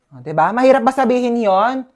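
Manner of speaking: ordinary talk, nothing marked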